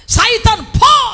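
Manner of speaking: loud, high rebuke; rebuking shout. Retold or imitated. Preacher rebuking the flatterer as Satan, loud shout.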